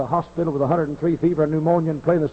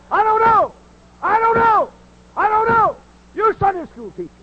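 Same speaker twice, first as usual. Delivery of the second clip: fiery preaching; 8 semitones higher than usual. Acted. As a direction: hammer the congregation from the pulpit. Preaching at full force.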